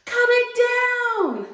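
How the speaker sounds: high, loud storybook shout; urgent cry stretched on each word. A narrator's reading. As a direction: holler it down from far above. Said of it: "Jack shouted down to his mother".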